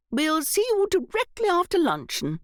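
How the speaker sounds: high, lightly voiced aunt; a touch of a grand old lady; older aunt voice. Aunt Em on telephone.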